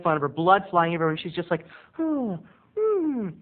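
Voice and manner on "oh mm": high, mildly mock-horrified moan; understated acting. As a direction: undersell the horror on purpose. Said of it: "Understated acting".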